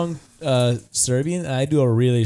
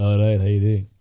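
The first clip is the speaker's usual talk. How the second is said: put-on lower-pitched Montenegrin accent; put-on Montenegrin accent, deeper; Ilya Orlovich; 5 semitones lower than usual. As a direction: greet like a Montenegrin boss. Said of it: "Ilya Orlovich".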